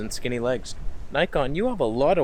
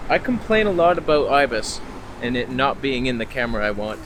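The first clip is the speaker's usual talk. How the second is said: ordinary delivery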